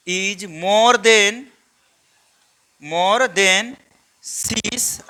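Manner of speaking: each word spaced out with long pauses, very slow; slow dictation. Shown as a demonstration. Teacher dictating a note for students to copy, slow and spaced. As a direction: dictate it slowly so the class can write it down. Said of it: "यहाँ पे लिखेंगे due to".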